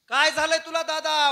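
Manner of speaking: high, very loud, distraught; acted full-force alarm; anguished shouted question. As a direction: cry out in alarm to a stricken brother. Brother rushes up crying out.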